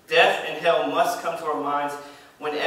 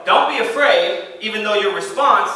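high and loud "Don't be afraid"; raised earnest call; urgent plea; 4 semitones higher, 6 dB louder than usual. Acted. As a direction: cry out the reassurance. Preacher urging congregation.

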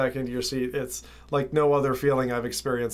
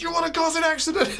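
high, fast, furious mock yell; angry cop shouting; 14 semitones higher, faster than usual. Cop shouting at John for cutting through the cones, high fast angry yell. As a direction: scold the driver like a livid cop. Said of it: "he was shouting at John".

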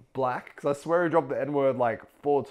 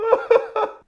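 sudden high laugh; high burst of laughter; 8 dB louder than usual. Real, not acted. Laughing at reaction.

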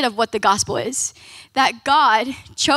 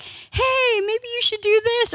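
God's voice much higher, gentle nudge; voicing God; 10 semitones higher than usual. Preacher voicing God coaxing stubborn people, high gentle suggestion. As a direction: play God coaxing, lightly pitched up.

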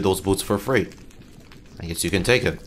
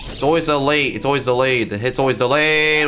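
frustrated rant; 6 semitones higher, 4 dB louder than usual. Real, not acted. Ranting at delayed hits.

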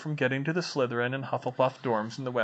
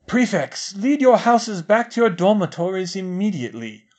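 thundered; 6 semitones higher, 7 dB louder than usual. A narrator's reading. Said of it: "he thundered in a magically amplified voice".

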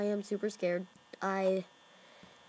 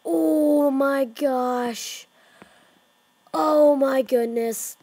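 slow, louder dread; drawn-out fright; 3 semitones higher, 6 dB louder, much slower than usual. Real, not acted. Gamer groaning slowly in fright. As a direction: stretch it out shaken.

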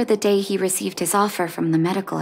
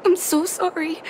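tearful apology; 7 semitones higher than usual. Acted. Apologizing to mother after father's death.

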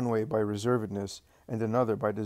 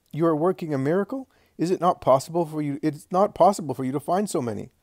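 higher, louder and faster, astonished; amazed; 5 semitones higher, 5 dB louder, faster than usual. Acted. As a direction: blurt out the wonder, rushing. John amazed at the miracle.

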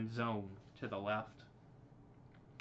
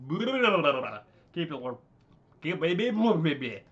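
loud, real flub dissolving into babble; tongue-tied babbling; 6 semitones higher, 12 dB louder, much slower than usual. Real, not acted. Reader botches the blaster line aloud, tongue-tied babbling. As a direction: trip over the words into high, loud gibberish.

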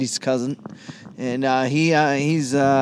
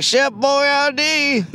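imitating the girl; 10 semitones higher, much slower than usual. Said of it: "she was like".

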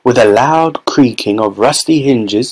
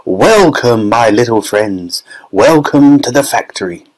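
plain reading voice

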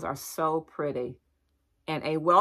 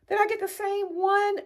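pitched-up baffled question; high puzzled squeak. Real, not acted. Shopper puzzling to viewers over her own purchase, high squeak. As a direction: ask it high, confused.

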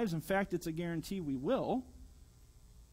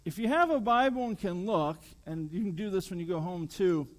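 usual speaking voice

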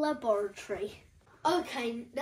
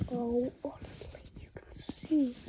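whispering. Real, not acted. Awed at the crystals.

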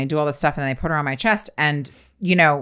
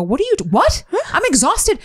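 high, incredulous protest at the midwives; mock outrage, voice jumping up; high exasperated disbelief; 13 semitones higher than usual.

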